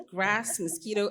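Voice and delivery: ordinary talk, nothing marked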